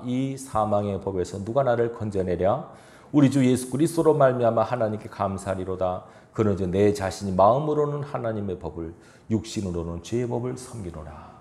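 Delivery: measured, solemn Bible reading; scripture reading. Acted. Reads Romans aloud. (성경 봉독하듯 차분하고 엄숙하게)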